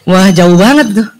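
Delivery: ordinary talk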